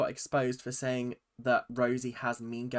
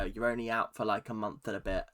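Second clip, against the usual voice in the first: ordinary delivery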